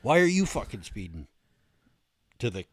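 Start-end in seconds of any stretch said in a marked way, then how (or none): 0.0-1.3 imitated driver throwing the question back; retold driver's retort. Speeding driver talks back to the trooper, retorting. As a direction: echo the trooper's question, a bit raised. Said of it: "the guy in the car goes".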